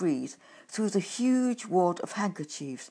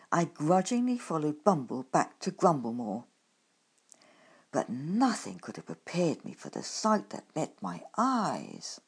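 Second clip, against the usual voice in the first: ordinary delivery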